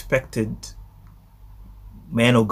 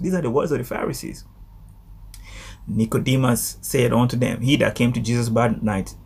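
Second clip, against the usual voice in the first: usual speaking voice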